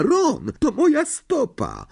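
high, pained protest; crying out. A narrator's reading. Hermiona scolds Ron for stepping on her foot, crying out. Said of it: "krzyknęła Hermiona".

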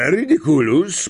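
brisker, shorter spell call; almost casual. A narrator's reading. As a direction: toss off the incantation lightly. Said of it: "powiedział Lapin, prawie od niechcenia".

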